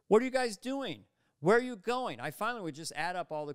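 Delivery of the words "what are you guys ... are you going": higher, louder sitcom straight man; straight man asking questions. Retold or imitated. Straight man in a sweater asking questions, high and loud. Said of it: "asking questions".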